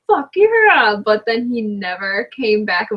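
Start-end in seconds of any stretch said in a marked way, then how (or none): 0.1-1.0 excited cheer. Retold or imitated. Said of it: "we're all like".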